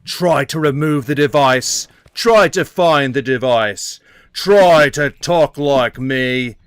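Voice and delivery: loud, slow, drawn-out Australian villain; Australian accent. Acted. Bomber giving the store worker an ultimatum, Australian accent. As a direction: deliver bomb threats in broad Australian. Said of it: "Why did he turn Australian".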